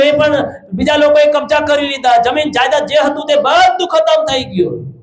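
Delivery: high, sustained proclamation; forceful preaching. Real, not acted. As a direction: declare each promise like a preacher at full pitch. Preaches Job's losses.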